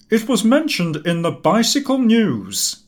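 a huffy Cummings snapping back his proof; indignant retort. Acted.

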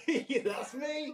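slow, high laughing reply. Real, not acted. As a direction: laugh through the words. Laughing at being recognised.

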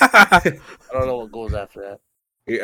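laughing through the words. Real, not acted. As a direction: giggle while speaking. Laughs at forgotten line.

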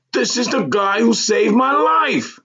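high-pitched, exuberant proclamation; excited announcement. A narrator's reading. Hawk telling wife who saved his life, excited announcement. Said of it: "Hawk announced".

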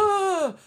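startled gasp. Retold or imitated. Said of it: "They're like".

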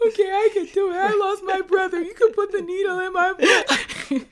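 high, loud, laughing and tearful at once; teen bravely giving in to the needle, laughing through tears; laugh-crying. Retold or imitated. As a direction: cry and laugh through a brave pep talk. Laugh-crying while retelling.

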